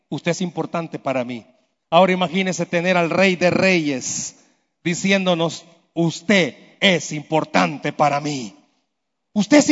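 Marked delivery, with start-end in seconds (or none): 0.1-1.5 reassuring dignitary. Retold or imitated. Dignitary telling you your worth. 5.9-8.5 tender divine voice. Retold or imitated. Preacher voicing the King of Kings telling the congregation, tender and slow.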